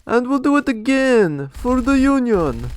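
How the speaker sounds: rally the troops for the Union; mock patriotic cheer. Narrator mocks Soviet zeal with a hammed-up cheer.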